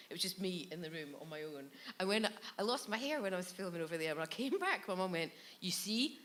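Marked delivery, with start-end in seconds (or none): 5.6-6.2 lightly voiced scolding mum, building louder; a hint of a mum's dire warning. Mum's ominous warning, acted out.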